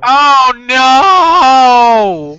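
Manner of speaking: anguished shout. Real, not acted. Dismayed the woman is back.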